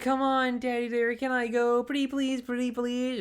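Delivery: put-on high, pleading little-kid voice; begging child. Pinocchio begging Daddy Derek to let him go, high childish plea. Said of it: "He's like".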